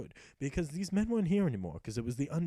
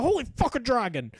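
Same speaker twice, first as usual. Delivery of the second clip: high, loud shock at a dragon; real surprise, much higher and louder; startled shout; 8 semitones higher, 8 dB louder than usual. Real, not acted. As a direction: yelp at the sudden dragon. Dragon appears suddenly in game.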